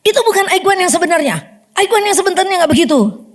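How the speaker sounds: high, fast, scolding rebuke; fast angry retold rebuke; retold scolding prayer. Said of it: "doanya tuh udah bentak-bentak".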